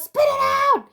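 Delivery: high, loud shouted order; retold yell. Friend yelling at him to spit out the water, high and loud. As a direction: bark the command like a panicked friend. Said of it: "he goes".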